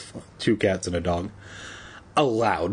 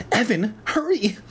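ordinary delivery, nothing marked

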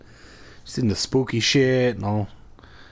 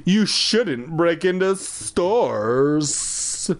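slow scolding; 5 semitones higher, much slower than usual. Acted. Man scolds boy.

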